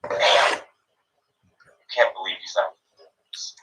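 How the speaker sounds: hushed, breathless "Damn, I can't believe"; soft, whispered envy; whispering haters. Retold or imitated. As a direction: whisper it like jealous gossip. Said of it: "all my haters are gonna go".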